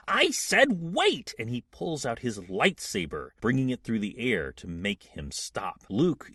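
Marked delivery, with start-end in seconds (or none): 0.1-1.4 high, slow, forceful order; raised-voice warning, drawn out; commanding shout. Acted. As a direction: stop the attacker with each word. Luke orders attacker to stop.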